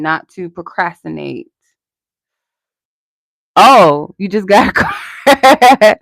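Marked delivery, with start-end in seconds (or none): 3.6-6.0 louder, slowed "oh, you just got a car"; light up as it clicks; surprised realisation. Real, not acted. Tarot reader realising the viewer's news, surprised. Sudden realisation from chat comment.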